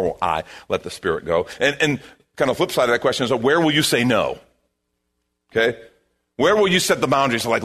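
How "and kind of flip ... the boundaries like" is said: usual speaking voice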